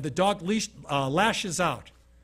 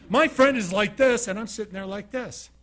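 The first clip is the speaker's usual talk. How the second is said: usual speaking voice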